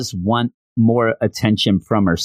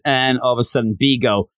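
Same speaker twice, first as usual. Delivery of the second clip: ordinary delivery, nothing marked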